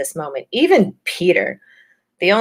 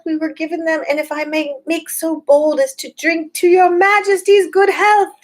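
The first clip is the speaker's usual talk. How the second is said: high, squeaky little fox; fox's nervous toast; 11 semitones higher, 3 dB louder than usual. A narrator's reading. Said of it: "said the fox".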